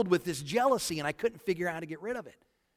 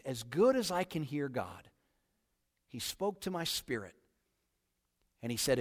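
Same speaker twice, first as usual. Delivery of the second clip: ordinary talk, nothing marked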